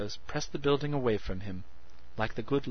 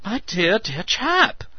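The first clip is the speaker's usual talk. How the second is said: excited; 11 semitones higher, 10 dB louder, slower than usual. A narrator's reading. Meadows excited voice.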